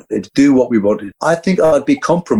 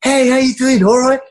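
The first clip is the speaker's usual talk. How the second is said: cheery rental staff; 10 semitones higher than usual. Retold or imitated. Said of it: "people are going".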